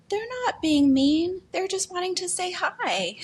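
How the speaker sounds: imitating a small child, high-pitched; little girl. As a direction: do a little kid's sweet high voice. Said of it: "And she's like".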